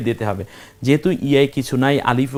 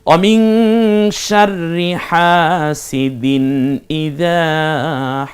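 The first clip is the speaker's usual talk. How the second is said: slow, drawn-out chanted recitation; Quranic recitation; 3 semitones higher, much slower than usual. Shown as a demonstration. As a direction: intone each word slowly like tajweed reading. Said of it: "teacher recites Quran verse".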